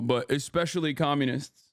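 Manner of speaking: ordinary talk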